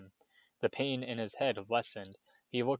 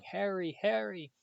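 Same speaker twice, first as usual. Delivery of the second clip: crying out; 10 semitones higher than usual. A narrator's reading.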